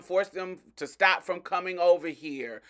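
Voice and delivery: normal talking voice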